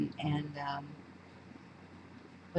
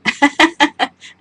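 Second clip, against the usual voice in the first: laughing; 8 semitones higher, 11 dB louder than usual. Real, not acted. Laughing about the weather.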